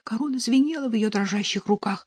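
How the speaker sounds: usual speaking voice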